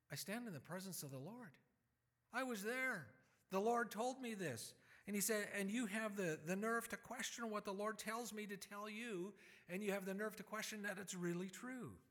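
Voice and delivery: a put-out angel scolding a doubter; indignant angel. Retold or imitated. Preacher voicing Gabriel rebuking Zacharias, indignant. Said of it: "He almost sounds incensed, indignant".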